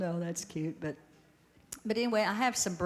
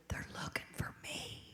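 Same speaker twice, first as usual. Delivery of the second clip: whisper; 10 dB quieter than usual. Retold or imitated. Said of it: "he whispered when he answered".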